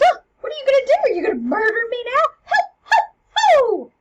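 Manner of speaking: very high, loud panicked shrieks; hammed-up hysterical cry; upset screaming. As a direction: scream for help in mock panic. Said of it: "when she screams".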